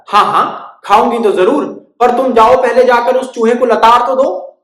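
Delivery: high, loud scheming bird; bossy bird. Acted. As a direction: order it slyly. Bird asks cow to scold mouse.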